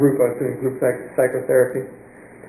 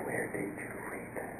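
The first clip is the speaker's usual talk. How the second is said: breathless whisper, repeating the question; 19 dB quieter than usual. Retold or imitated. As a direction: whisper it in awe, barely a breath. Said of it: "And he said".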